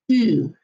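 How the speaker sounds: "ew" said as French "ü"; puckered French U. Shown as a demonstration. Teacher showing class correct French U, puckered "ü". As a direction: smush the lips, short pure "ü" for "ew". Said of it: "you do wanna smush those lips".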